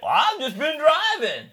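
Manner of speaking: high, loud, slow innocent excuse; imitating Randy. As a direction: play it dumb and drawn out. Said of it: "And he's like".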